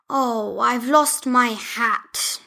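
slow, lightly voiced builder; a hint of a sad little builder; Julian's voice. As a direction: Julian the builder lamenting his hat. Julian character voice.

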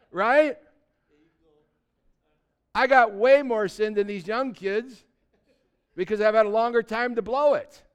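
loud, high, driving sermon voice; fired-up preacher calling out the older folks; preaching at full force. Acted. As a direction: pound it home to the congregation.

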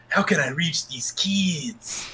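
slow hushed Cartman whisper. Retold or imitated. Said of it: "It's the Cartman".

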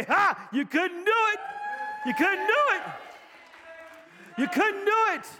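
triumphant preacher's taunt at the devil; defiant taunt. Acted. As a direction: throw it back in the devil's face, triumphant.

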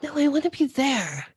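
put-on a light, pleading kid puppet; Elmo voice. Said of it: "And he's like".

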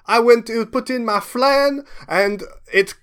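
high, slow French chef voice. Acted. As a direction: play the flustered French chef. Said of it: "he says".

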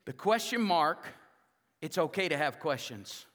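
normal talking voice

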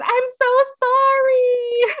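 high, slow, teary sorry; a high, tearful "I'm so sorry"; tearful apology. Retold or imitated. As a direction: plead sorry as if about to cry. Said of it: "we both at the same time said".

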